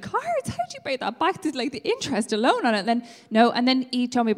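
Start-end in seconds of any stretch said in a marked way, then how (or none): 0.0-2.8 high-pitched, disbelieving questions; incredulous grown-up. Acted. Passenger reacting to taxi driver's gambling debt, incredulous. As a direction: squeak the first word, then question it like a sensible adult. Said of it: "the grown-up in me is like".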